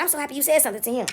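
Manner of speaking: softly voiced grateful bystander. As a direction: say it softly, as a private thanks. Retold confiding bystander.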